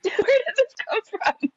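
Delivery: high, laughing through the question; can't stop laughing as you ask. Real, not acted. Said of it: "first take plain".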